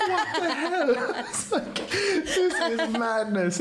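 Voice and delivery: high squeal of laughing shock, trailing slowly; laughing disbelief. Retold or imitated. Kevin on FaceTime seeing who Amy found, laughing disbelief.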